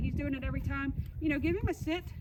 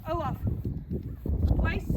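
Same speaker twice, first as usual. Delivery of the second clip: dog command; 2 semitones higher, 4 dB louder than usual. Shown as a demonstration. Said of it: "you're gonna have to walk over like this".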